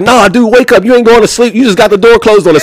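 put-on high, exasperated complaint from an older inmate; annoyed old head. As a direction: gripe at him like a fed-up old-timer. Old head waking the drunk.

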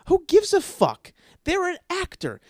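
high, loud outburst; outraged shout. Real, not acted. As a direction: yell it in disbelief. Outraged rant about celebrities.

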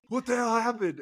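high-pitched startled parent; retold outburst of a confused father; alarmed dad. Dad walking in on bleeding child, alarmed. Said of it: "Dad comes in, and he's like".

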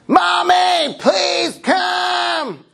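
put-on high shouted child's call, mommy please come. Pastor imitating his son calling from the bathroom, high shout. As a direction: yell it like a little boy stuck in the bathroom. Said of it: "I hear this".

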